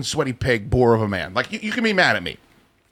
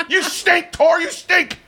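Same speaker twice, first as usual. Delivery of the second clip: taunting shout; 11 semitones higher, much slower than usual. Real, not acted. Host jeering at the engineer, high taunting shout.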